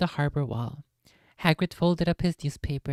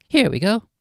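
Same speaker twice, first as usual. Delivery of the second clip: loud cheerful call; 5 semitones higher, 9 dB louder than usual. A narrator's reading. Hagrid announcing arrival.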